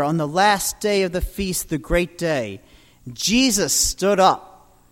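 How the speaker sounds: ordinary talk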